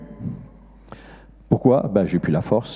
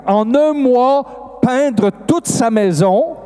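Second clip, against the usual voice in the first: high, driving testimony; impassioned preaching; 8 semitones higher than usual. Real, not acted. Preacher proclaiming how Jesus healed the woman, fervent. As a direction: build fervently, pitch held high, key words stretched.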